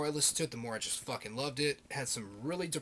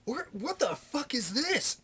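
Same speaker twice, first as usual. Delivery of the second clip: much higher, slow, incredulous; baffled shock; 11 semitones higher, slower than usual. Retold or imitated. Kid stunned by a strange new record, much higher and slowed. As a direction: stretch it out in disbelief. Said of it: "And it's like".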